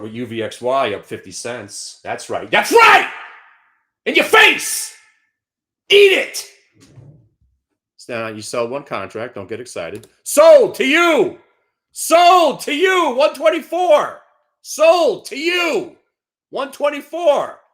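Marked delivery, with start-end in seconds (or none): 2.5-6.5 huge, high victory yell; triumphant shout. Real, not acted. Gloating over a winning short. 10.2-17.6 slow, loud, high hollering; auctioneer holler. Real, not acted.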